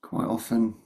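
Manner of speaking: calm meditation guide with long pauses; guided meditation. Real, not acted. Meditation teacher opening a session for the group, unhurried guidance with long pauses. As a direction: lead them gently in, leaving long silences between phrases.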